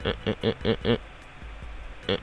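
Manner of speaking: ordinary delivery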